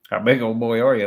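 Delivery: put-on half-hearted go at a gruff old prank caller; Roy D Mercer impression. Commentator trying a Roy D Mercer bit for his viewers, lightly voiced. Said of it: "I don't do a very good Roy D Mercer".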